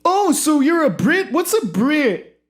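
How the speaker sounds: mock quoting the host. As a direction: parrot the host's squeaky question.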